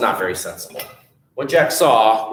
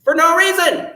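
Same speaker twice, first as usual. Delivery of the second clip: higher, indignant telling-off; storybook hero losing patience with his family; upset scolding; 12 semitones higher than usual. Acted. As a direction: scold them like a fed-up son. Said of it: "got very upset at this".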